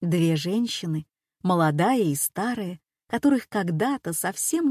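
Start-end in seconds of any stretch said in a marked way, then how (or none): none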